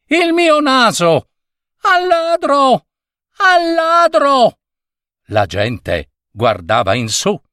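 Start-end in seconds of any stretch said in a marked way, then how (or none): 0.0-4.6 high, slow cry of thief; the robbed man raising the alarm; shouting. Acted. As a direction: wail it up high across the square. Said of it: "gridò il signore".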